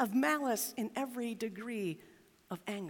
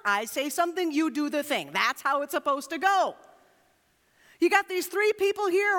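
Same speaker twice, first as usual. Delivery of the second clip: mock angry king; 6 semitones higher than usual. Pastor voicing Nebuchadnezzar to the congregation, indignant and raised. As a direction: puff up like an offended tyrant. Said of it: "why was Nebuchadnezzar so angry".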